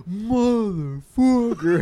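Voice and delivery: slow, loud, drawn-out curse; retold yell. Boy with spilled chili on him, drawn-out yell.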